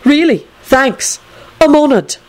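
high, drawn-out mock gratitude; sarcastic. A narrator's reading. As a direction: lay on the false gratitude. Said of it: "Neville replied sarcastically".

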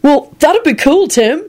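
high, upbeat approval; cheerful. A narrator's reading. George encouraging young Tim, cheerful. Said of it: "he said cheerfully".

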